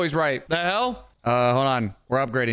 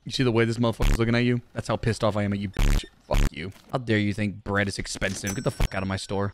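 mock angry; 10 semitones lower, faster than usual. Fake rage at customer over bread.

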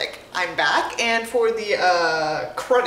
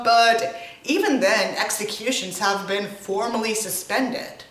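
ordinary talk

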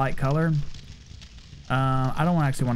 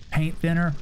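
ordinary talk

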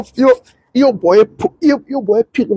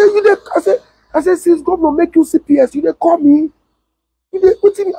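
mock indignant challenge; 9 semitones higher than usual. Retold confrontation, high voice.

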